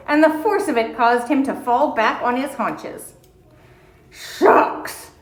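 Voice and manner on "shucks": deep tired voice. Acted. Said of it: "in his deep, tired voice".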